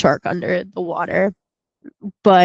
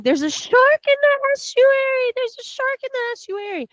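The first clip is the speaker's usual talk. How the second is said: high-pitched mock scream of a frightened girl. Girl by the pond screaming a warning, high mock shriek. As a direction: shriek it like a panicked young girl. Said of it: "it's the girl that had been painting".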